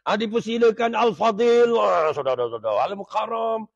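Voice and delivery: mock emcee's gushing introduction; pengacara majlis. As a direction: hail the guest with loud, swooping over-praise. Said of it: "Hak kita nak silakan ni". (gaya pengacara majlis memuji berlebihan)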